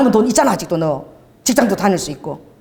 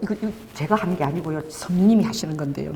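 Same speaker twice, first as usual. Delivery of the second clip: timid excuse; 5 semitones lower, 6 dB quieter than usual. Retold or imitated. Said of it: "너무 무서워가지고".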